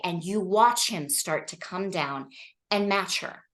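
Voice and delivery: ordinary talk, nothing marked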